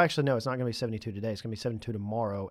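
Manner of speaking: ordinary talk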